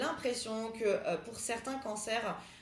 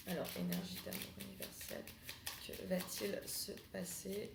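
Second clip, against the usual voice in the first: whispered invocation; 12 dB quieter than usual. Acted. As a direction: whisper the ritual call. Tarot invocation.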